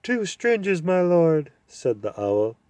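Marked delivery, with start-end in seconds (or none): 0.0-1.5 hooting owl. Acted. Owl hooting up at the deaf dwarf. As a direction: hoot it out like a fussy owl.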